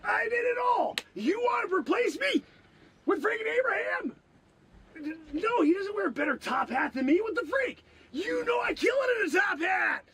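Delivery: high-pitched, indignant shouting; indignant outburst. Acted. Comic time traveler protesting being replaced, indignant.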